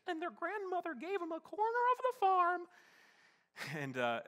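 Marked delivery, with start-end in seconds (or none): 0.1-2.7 put-on much higher and louder, a warbling elderly storyteller's voice; old lady's voice. Imitating the old lady's happy ending, high and quavering. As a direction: wrap up the tale like a proud grandma. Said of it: "she would always say".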